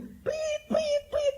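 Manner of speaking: very high, shrill whistle blasts; mock whistle blasts from a traffic cop; police whistle imitation. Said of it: "Dia mau bilang gini".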